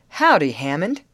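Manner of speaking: loud hail with a punched "Howdy"; hearty greeting. A narrator's reading. Contractor greeting the foreman, loud hail. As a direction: greet him loudly across the clearing. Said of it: "greeted the labor contractor".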